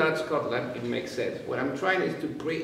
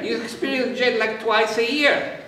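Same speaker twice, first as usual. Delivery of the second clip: normal talking voice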